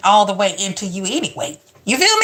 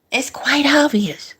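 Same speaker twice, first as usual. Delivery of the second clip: a whispered aside, breathy and unvoiced; 5 semitones higher than usual. Real, not acted. Co-host whispers a knowing aside to the audience, whispering. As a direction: lean in and whisper the obvious.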